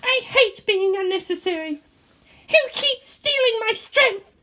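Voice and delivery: put-on high girl's voice, bitter and hurt; a high, bitter teenage girl; bitter young pilot girl. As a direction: voice the proud pilot, wounded. Voiced Asuka lines.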